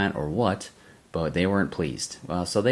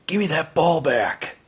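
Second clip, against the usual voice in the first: high, slow, mock aggressive demand; angry demand; 9 semitones higher, 4 dB louder than usual. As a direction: bark it at the dad like a drunk bully. Drunk golfers confront his dad.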